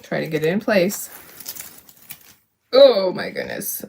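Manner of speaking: ordinary delivery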